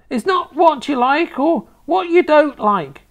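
high, loud grandma rebuke; snapped scolding. A narrator's reading. Grandma to a boy refusing cabbage, snapping. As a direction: cut him off in a high, loud old woman's voice. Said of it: "Grandma snapped".

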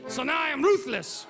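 usual speaking voice

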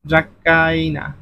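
drawn-out ড্রাকাইনা for students; slowly spelled out. Shown as a demonstration. Teacher spells out answer slowly. (ধীরে ধীরে ড্রাকাইনা)

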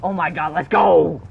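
building to a loud celebratory shout; excited cheer. Real, not acted. Gamer celebrating the team's position, excited shout.

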